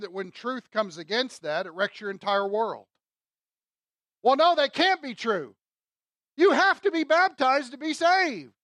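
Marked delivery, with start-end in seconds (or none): 4.2-8.5 loud, high mock protest; indignant objection. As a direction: insist on it like an affronted church traditionalist. Voices an objector defending denominational tradition.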